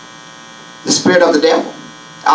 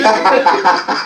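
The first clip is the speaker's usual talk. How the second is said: laugh. Real, not acted. Laughs after eardrum joke.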